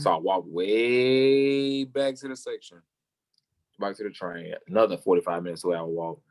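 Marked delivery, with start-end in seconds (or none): 0.0-2.8 WAY held long to show the distance; drawn-out WAY. Real, not acted. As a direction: stretch WAY out long and high. Stresses how long the walk was.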